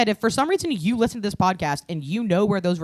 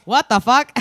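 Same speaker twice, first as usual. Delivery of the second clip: loud, high yell with rising end; shouted; 7 semitones higher, 11 dB louder than usual. Pretend. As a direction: yell it back even higher. Shouted reaction quote.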